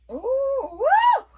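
high, loud delighted whoop; thrilled with the curls, high and loud; excited whoop. Real, not acted. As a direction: cheer it out. Excited by the result.

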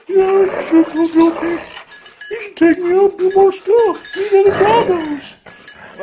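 guilt-trip in a high pup voice; high sulky dog voice. Pretend. Voicing the dog.

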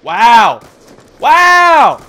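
loud, rising outraged wow; loud exasperated wow. Real, not acted. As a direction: shout it in disbelief. Reacts to bad luck in game.

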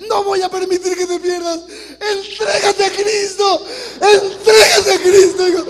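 very loud, slow, high crying shout; mother wailing at her son to give himself to Christ; wailing plea. Retold or imitated. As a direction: wail it out, begging him to give in. Mother begging son to convert.

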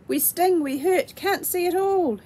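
pained cry. A narrator's reading. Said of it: "screwed themselves up tighter".

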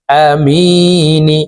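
আমিনি very slow, মি drawn three alif; long stretch. Shown as a demonstration. Teacher modelling the long vowel. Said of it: "মি তিন আলিফ টেনে".

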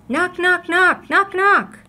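high, loud, insistent knocks; many visitors knocking loudly at the door. A narrator's reading. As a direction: bang out each knock.

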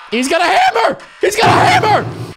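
high, loud alarmed yell; panicked shout. Real, not acted. Gamer spotting the neighbor's hammer, shouting in panic. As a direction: yell in real panic as he comes at you.

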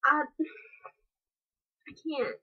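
ordinary talk, nothing marked

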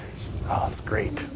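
hushed content sigh of a line; dreamy whisper. Acted. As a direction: whisper it in blissful wonder. Mary sitting contentedly at Jesus' feet.

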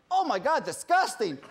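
high, loud complaint; upset human. Acted. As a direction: recoil in acted disgust. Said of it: "human obviously gets upset".

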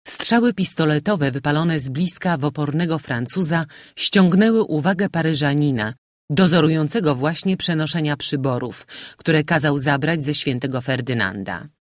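ordinary delivery, nothing marked

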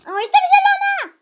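loud, high-pitched burst of excitement; excited shout. Real, not acted. Player announces her new green colour, excited shout. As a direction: shout it high in delighted surprise. Spots own color in game.

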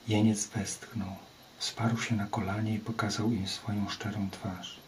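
ordinary delivery, nothing marked

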